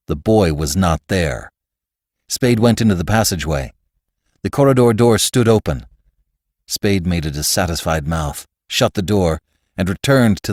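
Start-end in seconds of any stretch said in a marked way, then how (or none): none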